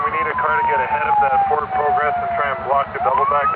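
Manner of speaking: high-pitched, loud urgent call; urgent call during a pursuit; urgent radio call. Real, not acted.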